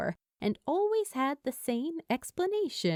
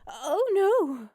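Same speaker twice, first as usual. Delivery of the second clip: flustered cry; 8 semitones higher, 6 dB louder than usual. A narrator's reading. Steed flustered, cries out.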